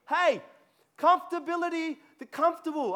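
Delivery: tickled by his own made-up word; playful exclamation. Real, not acted. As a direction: toss out the new word with glee. Playful aside about made-up word.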